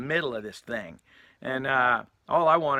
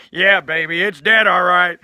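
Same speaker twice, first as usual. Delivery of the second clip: booming, higher-pitched acted reply; loud blunt confirmation; 5 semitones higher, 12 dB louder than usual. Storyteller voicing Andy answering Donna, loud and emphatic. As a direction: belt out the verdict.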